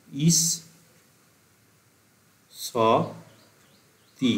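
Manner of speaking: ইস, স, তি with long gaps between; syllable by syllable. Shown as a demonstration. Teacher dictating ইস স তি syllables to students, spaced. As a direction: space out ইস স তি one syllable at a time. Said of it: "তিনটে syllable হচ্ছে".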